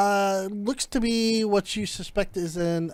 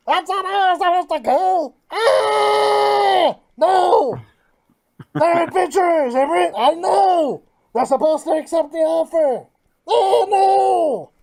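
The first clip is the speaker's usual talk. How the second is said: higher and louder, in a wailing panic; frantic shouting; 8 semitones higher, 8 dB louder than usual. Acted. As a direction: cry out as if the plan just fell apart. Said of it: "in a muffled voice".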